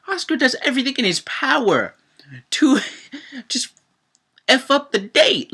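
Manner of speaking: high, loud outraged telling with a laugh; outraged disbelief. Real, not acted. Vlogger outraged that Oscar ruins the date, high and loud. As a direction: sound fed up at him.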